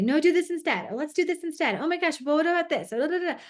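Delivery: high, eager jumping in; interrupting parent. Acted. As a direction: butt in with suggestion after suggestion, pitched high. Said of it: "we might wanna jump in and say".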